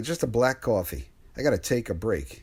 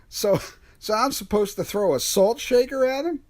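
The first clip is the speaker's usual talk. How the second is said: normal talking voice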